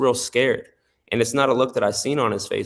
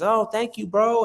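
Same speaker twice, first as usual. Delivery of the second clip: much higher, cheerful voice of the guy being paid; happy guy; 11 semitones higher than usual. Retold or imitated. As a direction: play the pleased creditor, bright and high. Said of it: "he's all happy".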